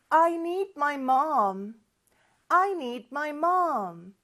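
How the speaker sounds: slow, stretched teacher's English; drawn-out words, evenly spaced; slow model sentence. Shown as a demonstration. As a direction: lead learners through it slowly. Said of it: "큰 목소리로 따라해볼까요".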